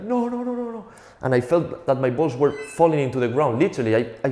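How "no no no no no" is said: very high, thin mock wail; imitated crying. Kicked boy crying in pain, high mock wail. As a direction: whimper it in a high child's voice, played for laughs. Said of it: "And I was crying like".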